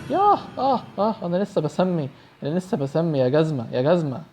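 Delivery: high panicked cries then angry scolding; scared yelling. Real, not acted. Player yelling at Slendrina as she attacks. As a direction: scream as the monster grabs you. Monster grabs him in game.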